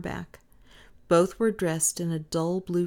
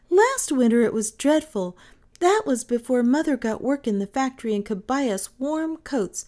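put-on raised, louder child's voice; little girl confiding; 6 semitones higher, 5 dB louder than usual. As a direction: recount a hard time in a high girl's voice. Little girl recalls last winter.

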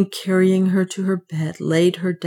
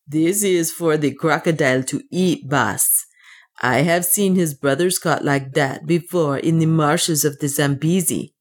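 plain reading voice

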